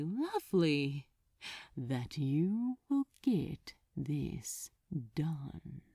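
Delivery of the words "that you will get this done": slow whispered threat, soft and sweet; a demon whispering a soft menacing order; alluring whisper. Acted. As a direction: breathe the command like a caress. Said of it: "so soft and alluring".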